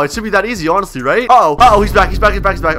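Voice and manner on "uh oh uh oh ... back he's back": loud, high-pitched panicky warning; playful alarm. Pretend. Inmate seeing the cop come back, alarmed. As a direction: call it out in a sudden fluster. Officer returns during escape plan.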